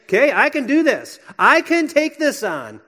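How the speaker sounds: high, loud and determined, psyching yourself up; self pep talk. Retold or imitated. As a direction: steel yourself out loud, half shouting. Voicing someone psyching themselves up.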